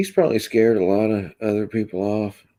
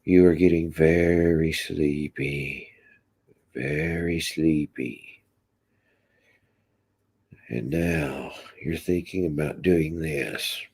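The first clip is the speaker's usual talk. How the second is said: slow, drawn-out hypnotist patter; 2 semitones lower, slower than usual. Pretend. Streamer playing at hypnotizing the viewers, slow hypnotist voice. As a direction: lull them like a stage hypnotist, slowly. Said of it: "You hypnotize us".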